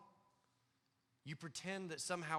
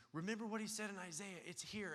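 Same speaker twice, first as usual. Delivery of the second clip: higher, pressing excitement; eager insistence; 7 semitones higher than usual. Retold or imitated. John insisting to Jesus, higher and eager. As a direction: urge it like proof in hand. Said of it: "John was saying".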